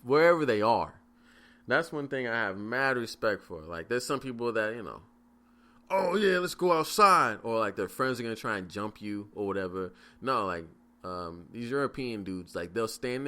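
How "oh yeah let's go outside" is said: high, loud mocking of a brawler itching to fight; mock macho challenge, higher and louder; mock tough guy. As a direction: ham up the eager fighter. Mocking guys eager to fight.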